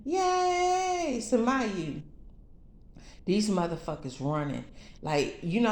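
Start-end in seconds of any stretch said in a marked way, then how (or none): 0.0-1.2 high, squeaky cheering crowd voice; chirpy little cheerleader squeal; cheering angels. Retold or imitated. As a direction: cheer her name like a tiny adoring crowd.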